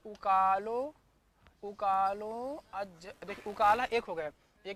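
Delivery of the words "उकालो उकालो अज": slow sutra reading. Shown as a demonstration. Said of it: "reads the sutra drawn out".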